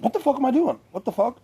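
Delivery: high, startled outburst; girl suddenly waking from the spell, shrill and shocked; alarmed. Retold or imitated. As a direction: snap out of it in a high panic. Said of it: "She's like".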